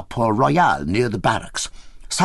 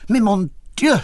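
high, loud dismayed cry; Yvonne cries out in alarm; cried out; 10 semitones higher, 4 dB louder than usual. A narrator's reading. She protests she is not pregnant, crying out high. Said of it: "she cried".

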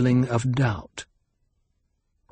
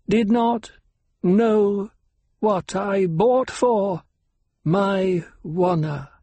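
slow, measured, word by word, higher; oddly measured cadence; 10 semitones higher, slower than usual. A narrator's reading. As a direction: space each word with careful precision. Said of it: "his voice came in oddly measured cadence".

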